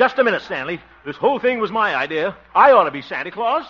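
high, loud protest; indignant objection. Acted. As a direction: stake your claim in a loud huff. Quarrel over who gets nominated.

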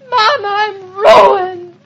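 high, slow tearful lament; drawn-out, crying despair; horrified wail. Acted. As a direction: wail to your mother as if all is lost. Daughter sees singed face in mirror.

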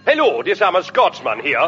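silly put-on voice for a Scotsman; un-Scottish comic Scotsman. Self-declared Scotsman greeting a shopkeeper, in a silly voice. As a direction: do a daft, wrong Scotsman voice. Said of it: "why are you talking like that?".

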